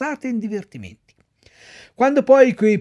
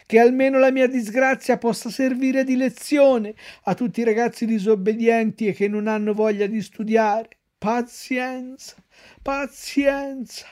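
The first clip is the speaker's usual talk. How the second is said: Pinocchio donkey voice; 7 semitones higher than usual. A narrator's reading. Pinocchio lamenting to himself, high and rueful. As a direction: moan the moral like a sorry little donkey. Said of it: "ripeté continuando a masticare".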